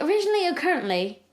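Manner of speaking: high-pitched fake British; put-on British accent. Replying to the band, put-on British accent. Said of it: "and I responded".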